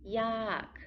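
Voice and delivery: yak with a falling tone. Shown as a demonstration. Teacher models Thai word yak with falling tone. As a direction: drop the pitch down on yak. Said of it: "Falling tone".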